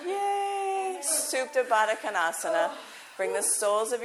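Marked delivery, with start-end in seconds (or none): 0.1-1.0 high cheering shout; a bright celebratory whoop. Real, not acted. Teacher cheering for Carolyn after the song, high shout.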